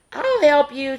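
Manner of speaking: high, kindly offered help; lightly voiced friendly young skunk; friendly helper. A narrator's reading. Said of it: "said a friendly voice".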